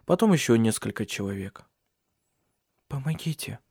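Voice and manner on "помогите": faint, breathy plea for help; weak whisper. A narrator's reading. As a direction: barely get the word out. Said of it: "прошептала Сесилия".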